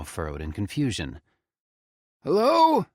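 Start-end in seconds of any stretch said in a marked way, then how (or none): 2.2-2.9 high, loud call from outside; distant call. Acted. Mystery voice calls toward the cabin, high and loud. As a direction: call out into the night. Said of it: "it called".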